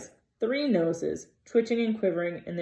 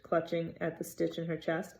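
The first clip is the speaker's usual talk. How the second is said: ordinary delivery, nothing marked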